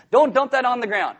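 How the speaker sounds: stern parent warning. Retold or imitated. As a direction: lay down the law to the little one. Parent scolding a toddler.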